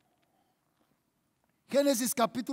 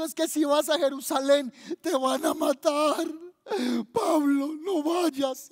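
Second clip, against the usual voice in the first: tearful disciples begging, voice a little raised; mock crying plea; 2 semitones higher than usual. Disciples beg Paul not to go to Jerusalem, acted crying. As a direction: plead as if weeping, hammed up. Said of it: "hay unos discípulos que están llorando, tristes por San Pablo".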